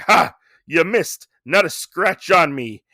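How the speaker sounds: hammed-up bravado; cocky taunt. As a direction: crow it like a cartoon braggart. Retold pig's cocky taunt.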